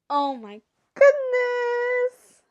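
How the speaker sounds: high, loud, stretched-out exclamation; overwhelmed wail. Real, not acted. Reader overcome by the brothers' moment, loud high wail. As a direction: let it soar, slow and squealing.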